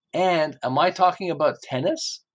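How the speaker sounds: ordinary delivery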